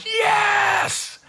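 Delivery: sharp high shout of joy; a leaping high "Yes!" at the TV; retold joyful yell. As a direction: yell it like your team just scored. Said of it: "and I'm going".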